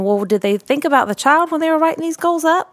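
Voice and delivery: pitched well up, incredulous; exasperated rhetorical question, voice way up; exasperated inner voice. Retold or imitated. SLP frustrated by test-based goals, incredulous voice. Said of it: "I'm thinking".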